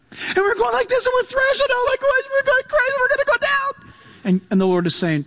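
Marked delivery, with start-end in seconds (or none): 0.1-3.7 high-pitched frantic squeal of panic; screaming panic. Acted. Emotions screaming at the listener, high frantic panic. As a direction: thrash about in a high, strained wail. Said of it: "throne of your emotions screaming at you".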